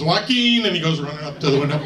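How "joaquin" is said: higher, louder eye-rolling correction; exasperated recruit. Acted. Recruit corrects his own mangled first name, exasperated. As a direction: correct him with weary exasperation. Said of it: "just kinda rolls his eyes".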